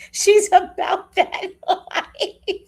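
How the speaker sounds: high, slow, laughing through it; the cat's tough line, laughed out high and slow. Retold or imitated. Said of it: "The cat said".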